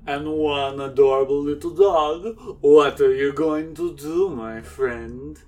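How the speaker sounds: theatrical count with a measured laugh. Acted. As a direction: play the gloating vampire count. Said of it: "the count is sitting at the table and is like".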